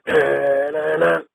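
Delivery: high vocal imitation of a riff; imitated sample riff. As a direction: voice the sampled stab high and sustained. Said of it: "it hit that".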